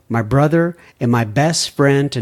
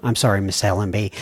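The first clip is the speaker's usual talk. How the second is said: ordinary talk, nothing marked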